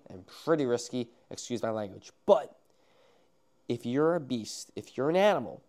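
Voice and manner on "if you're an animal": ordinary talk, nothing marked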